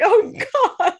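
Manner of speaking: embarrassed groan at own messages; a mock-horrified Oh God; cringing. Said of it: "and I'm like".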